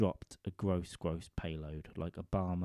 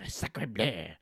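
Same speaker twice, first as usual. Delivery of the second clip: mock French; 3 dB louder than usual. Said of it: "shouted at me in French".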